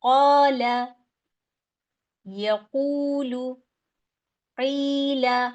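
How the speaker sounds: क़ाला, यक़ूलु, क़ीला read with long vowels held for two counts; recitation demo. Shown as a demonstration. Teacher showing madd in Quranic words, slow recitation. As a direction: recite each word slowly, stretching its long vowel. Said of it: "लफ्ज के साथ पढ़ूंगी तो कैसे पढ़ूंगी".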